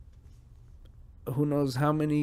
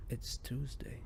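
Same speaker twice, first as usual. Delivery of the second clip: whispered to himself; breathy hushed repeat of the words; 12 dB quieter, slower than usual. Real, not acted. Podcaster whispering to himself. Repeating catchphrase after spacing out.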